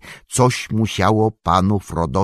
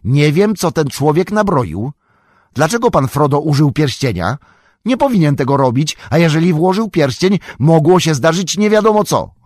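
higher and louder, worried hobbit; anxious outburst; 7 semitones higher, 6 dB louder than usual. Acted. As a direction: blurt out your worry for your master. Sam frets over Frodo and ring. (wzburzony, wyżej i głośniej, zatroskany hobbit)

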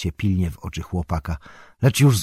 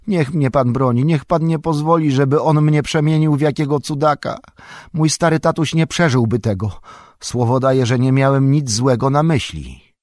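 higher pleading, a touch of fear; trembling plea; 7 semitones higher, 6 dB louder than usual. A narrator's reading. Sam begs Frodo to save him, a touch of fear. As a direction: beg for protection, a bit shaky. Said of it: "drżąc cały".

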